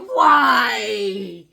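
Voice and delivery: long, loud high howl; hammed-up cry of despair; anguished wail. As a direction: howl it to the heavens, falling slowly. Retold wail over the package.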